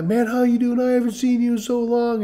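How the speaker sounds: high, enthusiastic imitation of an old pal; excited greeting. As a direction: gush at a long-lost acquaintance, mimicking him. Same acquaintance greeting him warmly.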